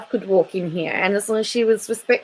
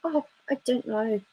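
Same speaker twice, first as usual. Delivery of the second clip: evasive mumble; 5 semitones higher, 8 dB quieter than usual. Retold or imitated. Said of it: "She's gonna say".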